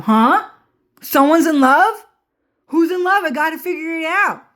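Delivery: high-pitched, excited nosy character; eager eavesdropper. Acted. He overhears that someone's in love, excitedly nosy.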